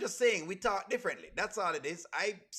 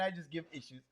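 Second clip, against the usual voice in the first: ordinary talk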